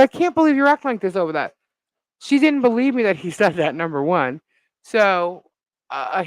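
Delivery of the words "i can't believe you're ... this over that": exasperated. Retold or imitated. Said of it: "I said".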